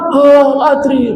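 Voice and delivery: high, helpless clueless whine; the lost soul who knows nothing; dazed unbeliever. Acted. Unbeliever answering the angels. (orang kafir yang bingung, suara tinggi memelas)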